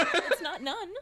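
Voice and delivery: laughing protest. Real, not acted. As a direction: laugh through the words.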